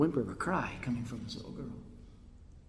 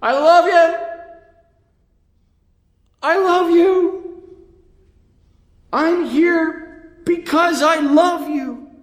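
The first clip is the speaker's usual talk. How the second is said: high, drawn-out impassioned shout; crying out; 10 dB louder, much slower than usual. Acted. Jesus on the cross declaring his love, crying out. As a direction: cry it out at full voice to everyone. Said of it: "He's crying".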